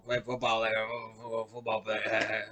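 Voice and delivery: very soft, slow Cajun mumble; Cajun coach impression. Podcaster still mocking Orgeron, soft slow Cajun growl. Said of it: "sounds like the fucking special teams coach".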